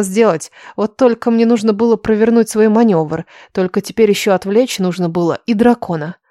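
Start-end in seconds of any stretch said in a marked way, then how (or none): none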